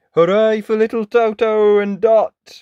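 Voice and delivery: loud, high hooray; cheering. Acted. Friends celebrating the witch's defeat, loud cheer. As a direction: shout a jubilant cheer.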